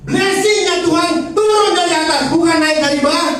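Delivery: high, loud preacher's cry on turun and bukan; fiery preaching. Acted. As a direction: proclaim it to the congregation. Preaching at full force.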